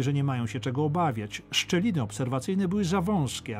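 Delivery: ordinary delivery, nothing marked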